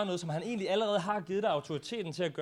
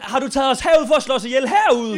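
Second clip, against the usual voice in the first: loud, high-pitched complaint; acted accusation; 4 semitones higher, 12 dB louder than usual. Interpreter as Israelites blaming Moses, loudly indignant. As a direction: echo the crowd's indignant challenge.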